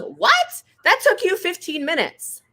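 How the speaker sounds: high, loud, indignant disbelief; shocked lady. Retold or imitated. The lady protesting Picasso's price, high shocked squeal. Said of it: "she goes".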